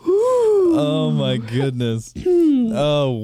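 high, loud, slow gasping delight; overjoyed cry. Real, not acted. Player reacting to a big win, overjoyed cry. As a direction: let the joy burst out.

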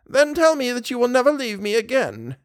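put-on high-pitched old woman's plea; imploring. A narrator's reading. Widow begs her daughter to stay, imploring. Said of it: "implored the widow".

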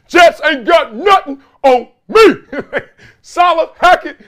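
hammed-up bellow of a rogue cop; Denzel Training Day. Payton as Denzel roaring at the Jets, hammed-up shout. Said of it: "he gonna get his Denzel in training day on".

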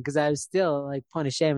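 usual speaking voice

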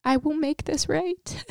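high, slow, choked-up voice; real emotional. Real, not acted. Recalling what she longed to hear from the Lord, emotional. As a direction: overcome with feeling, pitch lifted well up. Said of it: "I'm emotional".

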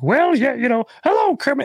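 high, bright Grover impression. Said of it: "he's always like".

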